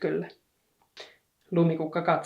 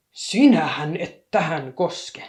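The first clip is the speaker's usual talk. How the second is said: loud, slow, protective exclamation; exclaimed; 6 dB louder, slower than usual. A narrator's reading. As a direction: shout a warning to keep her hands off. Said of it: "hän huudahti".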